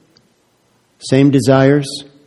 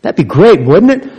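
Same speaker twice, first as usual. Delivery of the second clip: usual speaking voice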